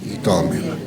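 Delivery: slow, measured Bible reading; scripture reading. Acted. Preacher reading the verses aloud to the congregation, slow and measured. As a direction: read each verse out slowly and deliberately.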